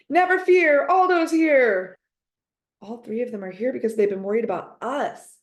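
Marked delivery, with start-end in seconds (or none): 0.1-2.0 high, loud, slow rallying cry; triumphant shouted entrance; heroic shout. A narrator's reading. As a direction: boom it out like a hero arriving. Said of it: "rushes into the room shouting".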